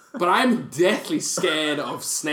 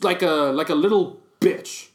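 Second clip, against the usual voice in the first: normal talking voice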